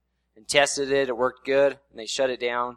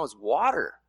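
ordinary talk, nothing marked

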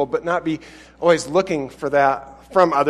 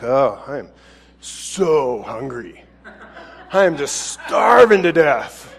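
lower, very slow, moaning self-pity; mock somber faster; 3 semitones lower, slower than usual. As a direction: drag it out like a martyr starving. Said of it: "don't walk around all somber".

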